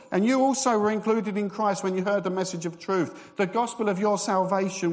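loud, fast, high reading in one breath; excited scripture reading without a pause; breathless fast reading. Shown as a demonstration. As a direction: rush through without stopping, full of fervour. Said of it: "So he starts off, and here we go".

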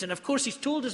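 usual speaking voice

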